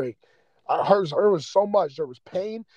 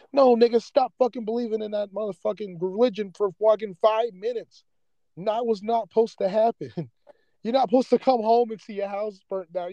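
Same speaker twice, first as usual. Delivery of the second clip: higher-pitched, emotional ranting; worked-up anger; 4 semitones higher than usual. Real, not acted. Podcaster railing against excuses for people's suffering, worked up. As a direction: argue it heatedly, fed up. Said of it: "I'm getting mad emotional".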